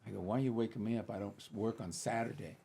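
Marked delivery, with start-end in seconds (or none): none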